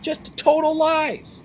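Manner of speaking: slow, drawn-out disgust; exasperated. Real, not acted. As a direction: drag out "Just total lies" in disbelief. Disgusted remark.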